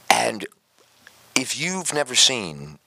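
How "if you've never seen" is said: normal talking voice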